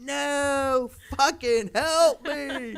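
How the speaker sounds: high, slow, laughing cry for help; laughing plea. Retold or imitated. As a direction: beg through laughter. Stranded, pleading to passing boat.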